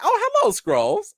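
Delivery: high, breezy mock-posh greeting; a lightly amused, unbothered hello; nonchalant Sonya impression. Recapping the episode, mimics Sonya greeting the Skrulls nonchalantly. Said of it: "I just love how she was like".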